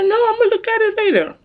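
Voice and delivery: quick, high, insistent brush-off; mock refusal. Daughter mimics mom putting off the pictures, high-pitched refusal.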